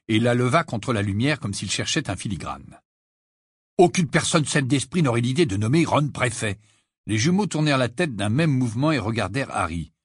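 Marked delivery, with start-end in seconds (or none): none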